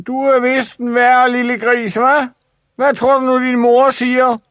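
put-on high taunting boys' voice throughout; jeering bullies. Big boys teasing Ivan about his mother, high taunting voice. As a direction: sneer the question up high. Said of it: "råbte de".

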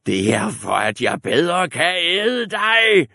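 slow loud wolf roar. A narrator's reading. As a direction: bellow it slowly. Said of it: "brølede ulven".

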